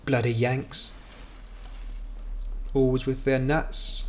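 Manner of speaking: put-on lightly done Australian accent; a hint of grumbling Aussie; Australian character. Blaming the Americans for truck nuts, mock Australian character. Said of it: "Don't know why he's Australian".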